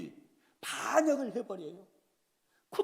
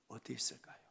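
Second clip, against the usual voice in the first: whisper; 8 semitones lower, 13 dB quieter, faster than usual. Real, not acted. Whispered rhetorical question.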